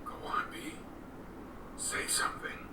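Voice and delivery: soft unvoiced whisper, barely there. Father whispering to his silent son, hushed whisper. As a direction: coax him gently under your breath.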